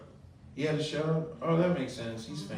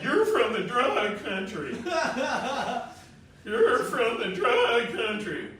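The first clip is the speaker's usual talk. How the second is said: lightly voiced kids, a bit higher and slow; mocking kids; 7 semitones higher, slower than usual. Tennessee kids teasing the new boy, mildly mocking. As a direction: tease him like schoolkids, drawn out. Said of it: "the kids were like".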